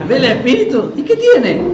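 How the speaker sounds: loud, high-pitched comic retort; mock unimpressed bystander. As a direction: imitate a dismissive passer-by, laughter around. Mocking the unimpressed passer-by's reply.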